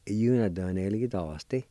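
Iunotonelitos Ti at natural speed, yoo-nah-dah-neh-lee-tahs-tee; fluent Iunotonelitos Ti; Cherokee pronunciation. Shown as a demonstration. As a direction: say Iunotonelitos Ti smoothly. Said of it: "it's pronounced like this".

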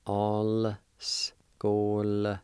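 All skoldiski drawn out very slowly with gaps; syllable-by-syllable pronunciation. Shown as a demonstration. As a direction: hold each syllable of All skoldiski apart. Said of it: "is pronounced like this".

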